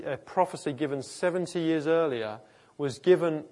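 ordinary delivery

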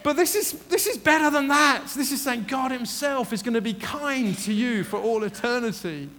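loud, high, impassioned preacher; full-force preaching. Real, not acted. Preacher assuring the congregation they are never left, impassioned.

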